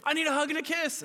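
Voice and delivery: put-on very high, urgent toddler voice; imitated small child. Toddler stopping Dad from leaving at bedtime, urgent childlike plea. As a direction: squeal a hurried bedtime plea. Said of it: "they're at the age".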